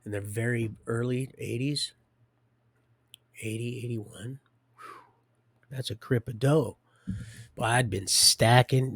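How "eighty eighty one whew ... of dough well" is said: usual speaking voice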